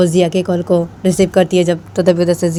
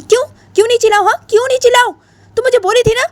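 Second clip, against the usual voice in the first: very high and louder, rattled off fast; louder, faster protesting girl; high indignant shout; 4 dB louder, faster than usual. Acted. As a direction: fire back at the friend in a shrill yell.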